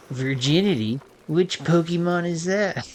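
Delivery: high, slow, loud nerdy voice; nerd voice. Retold or imitated. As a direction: ask it like a clueless nerd. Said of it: "Like, in that voice".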